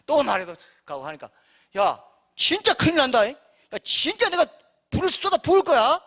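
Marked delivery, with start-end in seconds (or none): 1.8-6.0 much higher and louder, raging warning; God's angry threat. Retold or imitated. Preacher voicing God threatening fire, loud angry warning. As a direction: threaten fire like a furious father. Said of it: "맨날 지금 이러고 계세요".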